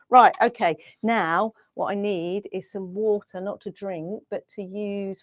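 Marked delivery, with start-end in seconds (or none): none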